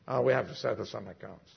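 hushed low retake. Retold or imitated. Jesus repeated, low and hushed.

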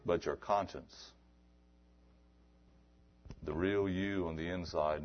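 ordinary talk

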